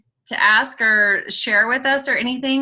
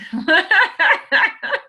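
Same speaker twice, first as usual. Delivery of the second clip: laughing; 10 semitones higher, 4 dB louder than usual. Real, not acted. Laughing at own joke.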